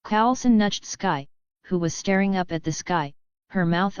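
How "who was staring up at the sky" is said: usual speaking voice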